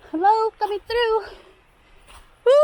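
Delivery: high, loud call to wildlife. Real, not acted. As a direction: call out so animals hear. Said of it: "Letting wildlife know I'm here".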